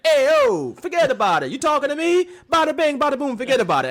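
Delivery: loud mocking New York Italian accent. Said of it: "Italian stereotype".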